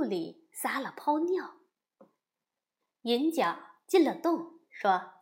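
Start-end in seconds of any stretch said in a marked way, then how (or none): none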